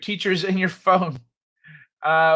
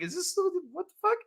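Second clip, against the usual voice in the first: high-pitched, softer disbelief; mock bafflement; 11 semitones higher, 9 dB quieter, much faster than usual. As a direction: squeak out a baffled reaction. Retells own bafflement at chat fight.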